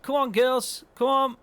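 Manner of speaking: loud high herding call; hollering at the cows to move. Real, not acted. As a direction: shout the cows along, urging them on.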